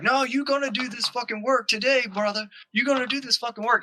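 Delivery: mock belligerent order; retold angry demand. Retelling an angry homeowner's demands.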